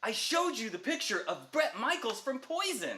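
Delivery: put-on a rock-kid son arguing back high; whiny teen son. Son defends his band clothes.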